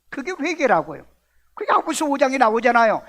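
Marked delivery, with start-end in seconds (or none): none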